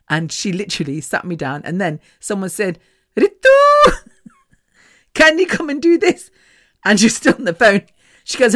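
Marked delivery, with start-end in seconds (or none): 3.2-6.3 calling out. Retold or imitated. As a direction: imitate someone summoning from another room. Someone calling her over, acted out.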